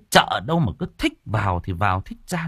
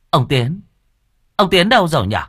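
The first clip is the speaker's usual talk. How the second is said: surprised calling; 8 semitones higher, 6 dB louder than usual. A narrator's reading. Calling the departed spirit.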